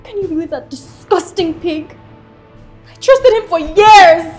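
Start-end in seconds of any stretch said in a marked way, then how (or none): 2.9-4.3 loud, high, angry cry; hurt and furious shout; betrayed outburst. Acted. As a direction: vent the betrayal at full volume. Betrayed woman vents after he leaves.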